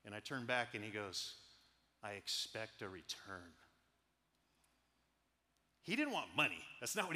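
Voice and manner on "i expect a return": low, slow, weighty mentor voice; solemn mentor. Retold or imitated. Mentor's weighty words retold.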